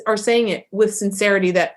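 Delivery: normal talking voice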